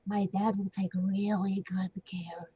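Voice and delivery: soft breathless threat; menacing whisper. Acted. Ophelia threatening the old man up close, whispering. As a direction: whisper it sweetly into his ear. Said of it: "put a hand on his shoulder and just go".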